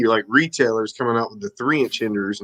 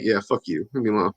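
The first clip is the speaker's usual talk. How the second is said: mock grumpy mom; 5 semitones lower, 6 dB quieter, faster than usual. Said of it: "My mom's like".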